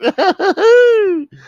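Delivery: very high, loud cackle; boastful mock laugh. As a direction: burst into a squealing show-off laugh.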